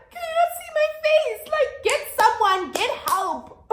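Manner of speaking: high-pitched frantic plea, acted out; shrill-pitched storyteller's panic, easing slightly at the end; retold panicked screaming. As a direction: cry out for help in re-enacted hysteria. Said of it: "I'm screaming at her".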